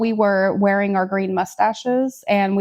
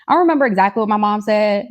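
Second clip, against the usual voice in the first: usual speaking voice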